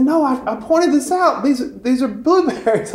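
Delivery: high, insistent, pointing it out; exasperated priest. Retold or imitated. Priest reminding the seminarian about the blueberries, high and exasperated.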